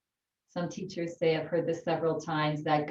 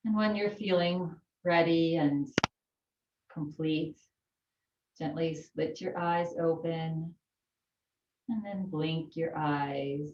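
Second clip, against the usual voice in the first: calm yoga teacher voice; unhurried breathing instructions; guided breathing. Acted. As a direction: talk them gently through the breath. Guides closing of practice.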